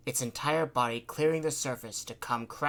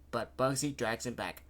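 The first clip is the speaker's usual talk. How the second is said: ordinary delivery, nothing marked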